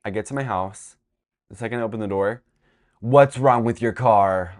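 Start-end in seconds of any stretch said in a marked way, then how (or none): 3.0-4.5 loud, slow imitation of an outraged mom; mock alarmed demand. Mom pounces as the door opens, loud mock alarm. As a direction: burst out, imitating a frantic parent.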